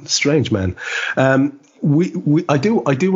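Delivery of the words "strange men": ordinary talk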